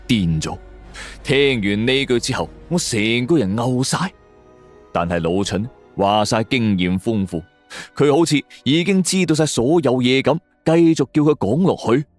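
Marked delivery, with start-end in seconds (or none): none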